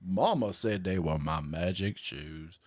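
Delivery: slow Southern drawl, lightly done; a light, slow take on Forrest Gump's Southern voice. Pretend. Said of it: "my Forrest Gump impression".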